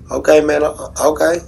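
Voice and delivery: ordinary delivery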